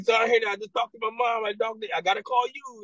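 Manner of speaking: put-on squeaky hurried kid on the phone from basic; imitating a recruit. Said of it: "They're like".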